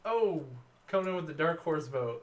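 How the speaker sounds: loud, high announcer-style call; excited call. Real, not acted. Player hypes the dark horse vote like an announcer. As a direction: hype up the surprise vote.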